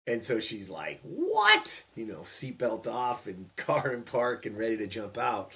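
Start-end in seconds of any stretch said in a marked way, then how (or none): 1.0-1.8 imitated high, loud shriek of outrage; irate mom's outburst. Mom hears what the coach said, imitated irate shriek. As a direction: shoot way up in pitch, loudly mimicking outrage. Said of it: "she was irate as any mom would be".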